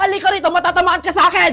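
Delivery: loud scolding yell; angry threat. Acted. Mother chasing child over test paper, furious.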